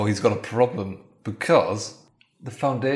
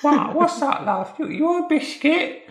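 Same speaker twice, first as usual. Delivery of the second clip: much higher, sweet and prim wife voice. Acted. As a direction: play the chirpy wife humouring him. Wife answers Dave.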